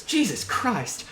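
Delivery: lightly voiced officer covering up trouble; halting reassurance from a harried captain, a touch forceful; Captain Lawrence, rattled. Game master plays rattled Captain.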